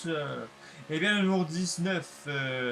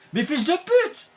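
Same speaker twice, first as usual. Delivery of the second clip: furious yell; 6 dB louder than usual. Real, not acted. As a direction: scream at the game in rage. Rage at the game. (cri de rage)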